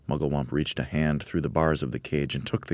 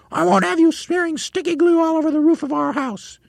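very high, louder shrieky hag voice; Missus Twit; 5 dB louder than usual. Acted. Missus Twit squawking at her husband. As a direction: squawk like a harridan.

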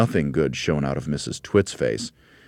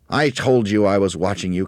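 louder, higher cackly gloat; cackling; 4 semitones higher, 6 dB louder than usual. A narrator's reading. Mrs. Twit gloats to Mr. Twit about watching him, cackling. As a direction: cackle it out triumphantly. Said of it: "cackled Missus Twit".

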